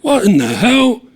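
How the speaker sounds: loud, high, slow shout; a cop startled and bellowing; alarmed shout. A narrator's reading. Cop shouting at the armed boys, alarmed.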